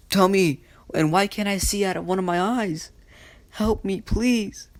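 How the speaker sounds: high-pitched acted begging; desperate pleading. Injured boy begs the nurse for help, desperate.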